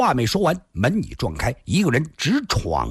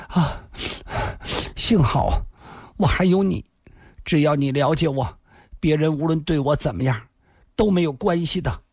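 high, delicate voice with halting starts; subsiding tearful voice; 6 semitones higher than usual. A narrator's reading. Woman calming in her lover's arms, tearfully grateful. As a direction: speak haltingly as the crying settles. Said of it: "林仙儿的哭声才低了下来".

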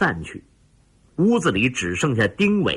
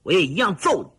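defiant threat, pitched high; high, defiant tough-guy warning; angry threat; 7 semitones higher, faster than usual. Acted. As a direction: throw down a challenge to fight. Threat during fight, collar grabbed.

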